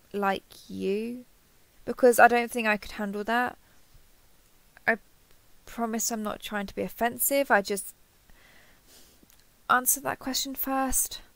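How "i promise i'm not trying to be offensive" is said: ordinary talk, nothing marked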